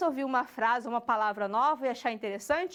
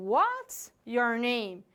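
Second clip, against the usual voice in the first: very slow, "What's" raised, "name" low at the end; falling question intonation; 3 semitones lower, much slower than usual. Shown as a demonstration. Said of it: "lá embaixo".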